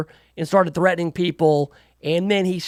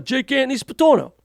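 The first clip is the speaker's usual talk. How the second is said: higher, hammed-up Italian-American mobster accent; mock Italian wiseguy; 6 semitones higher than usual. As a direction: say the name like a Brooklyn wiseguy showing off. Mocks Italian tough-guy name.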